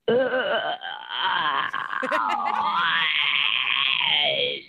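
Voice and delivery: long, low, sick-sounding ghost moan. Acted. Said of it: "whatever that sound was of the ghost".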